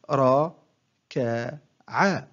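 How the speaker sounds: one short syllable at a time, with pauses; broken into syllables. Shown as a demonstration. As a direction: separate each syllable for a learner to copy. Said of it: "أقطع الكلمة إلى مقاطع قصيرة". (تقطيع الكلمة مقطعًا مقطعًا بوقفات)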